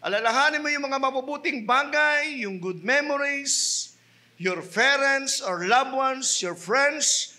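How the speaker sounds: high, impassioned sermon delivery; full-force preaching. Acted. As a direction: preach it hard to the congregation.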